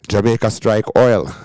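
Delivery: ordinary talk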